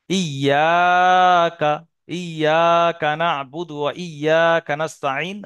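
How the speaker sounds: slow, drawn-out recitation, loud on the long vowels; Quran recitation. Shown as a demonstration. Teacher recites a verse as a reading example, slow loud recitation. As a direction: recite it slowly and strongly, holding each long vowel.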